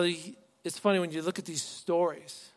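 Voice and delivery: ordinary talk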